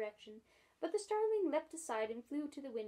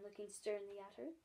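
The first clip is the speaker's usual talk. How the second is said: plain reading voice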